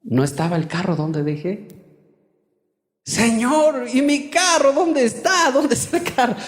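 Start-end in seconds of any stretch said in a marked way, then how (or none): 3.1-6.3 high-pitched mock panic to God; acted alarm. Retells finding his car missing.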